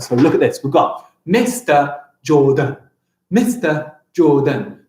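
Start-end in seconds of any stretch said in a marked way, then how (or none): 1.2-2.8 slow, measured "Mister Jordan" in separate beats; deliberate teacher's demo of "Mister Jordan"; syllable by syllable. Shown as a demonstration. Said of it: "Syllables are the beats in a word". 3.3-4.8 slow "Mister Jordan", one beat per syllable; measured, evenly split "Mister Jordan". Shown as a demonstration. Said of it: "I have got four syllables in my name".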